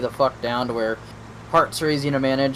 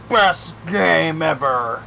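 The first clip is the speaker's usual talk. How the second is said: imitating Randy; 5 semitones higher, 7 dB louder, much slower than usual. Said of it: "Randy came in one night and goes".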